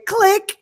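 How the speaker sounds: high-pitched mouth click sound; high mock click. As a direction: squeak out a click noise. Imitating a click hang-up.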